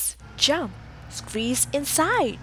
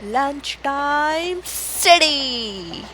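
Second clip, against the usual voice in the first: drawn-out announcement; 4 semitones higher, 5 dB louder, much slower than usual. A narrator's reading. Book refrain announcing the time.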